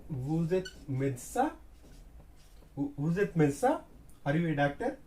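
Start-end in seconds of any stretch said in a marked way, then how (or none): 0.1-1.5 "médecin" climbing at the very end; rising question tone. Shown as a demonstration. French teacher giving an example question, end raised. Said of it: "we are raising the tone". 2.8-3.8 quick, with "médecin" jumping up at the end; rising question tone. Shown as a demonstration. French teacher repeating an example question, quick with raised ending. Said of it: "we are raising the tone".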